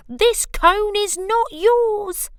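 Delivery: high, loud, slow protest; squeaky indignant squirrel. Acted. Cyril loudly telling Bruce the cone isn't his. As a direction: insist shrilly it isn't his.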